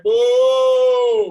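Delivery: loud mock boo; crowd booing. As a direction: boo him off the stage.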